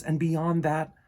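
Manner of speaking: put-on storybook narration for kids; reading to children. A narrator's reading. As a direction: read the story aloud to the class. Reads picture book to children.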